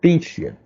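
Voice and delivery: "titian" cut out and stressed on its first syllable; stressed syllable demo. Shown as a demonstration. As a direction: isolate "titian" with weight on "ti". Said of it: "I have stressed litition".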